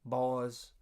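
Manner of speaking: lightly imitated Southern gentleman's accent, slow and measured; a hint of an old Southern dean's accent calling the boys; Dean Bailey's Southern drawl. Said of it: "he had a Southern accent like this".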